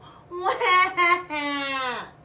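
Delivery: high loud burst of laughter. Real, not acted. Narrator laughing at the bunny's tantrum. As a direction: crack up at the tantrum.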